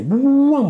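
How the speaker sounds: a sudden, very high, loud "buam"; loud crash noise. Retold or imitated. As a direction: burst out with the crash sound. Imitated crash of the patrol. (estruendo imitado, agudo y fuerte)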